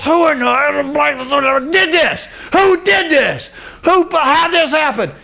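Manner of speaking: high, hammed-up angry outburst; outraged demand. King demanding who plotted against the queen, outraged. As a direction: imitate an enraged king demanding answers.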